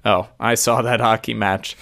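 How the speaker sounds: usual speaking voice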